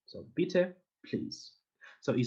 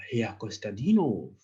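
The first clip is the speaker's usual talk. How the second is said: ordinary delivery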